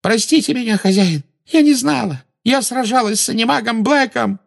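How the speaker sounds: high, loud, tearful pleading; Belatrisa wailing. A narrator's reading. Witch throws herself at her master's feet, wailing. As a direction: beg in a frantic wail. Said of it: "зарыдала Белатриса".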